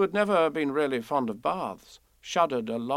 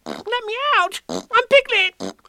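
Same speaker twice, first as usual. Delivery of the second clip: squeaky protest; 10 dB louder, slower than usual. A narrator's reading. Piglet protests in bath.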